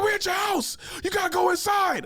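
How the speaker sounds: high, loud panicked shouting; panicked yelling. Retold or imitated. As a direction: yell it like you need to flee now. Homie urging him inside.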